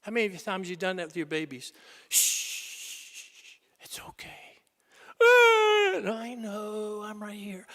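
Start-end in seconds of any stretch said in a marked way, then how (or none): 2.1-4.6 whispered comfort; soothing whisper. Retold or imitated. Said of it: "the mom picks it up or the dad". 5.2-6.1 high loud wail; mock baby cry. Imitating a baby crying. 6.1-7.7 much softer, gentle reassurance; soft comforting. Retold or imitated. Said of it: "the mom picks it up or the dad".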